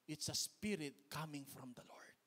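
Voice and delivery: slow, low whisper; hushed reverent whisper. Acted. Pastor tells the congregation, hushed reverent whisper. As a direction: breathe it out like a secret. Solemn point about the Lord's spirit.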